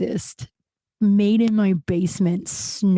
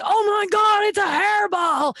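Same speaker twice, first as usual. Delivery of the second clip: imitated shriek; 12 semitones higher than usual. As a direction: shriek it like a roommate finding a hairball. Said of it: "a shrieking Andrew Stoltz".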